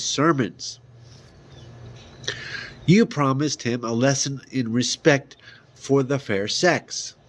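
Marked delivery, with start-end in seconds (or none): none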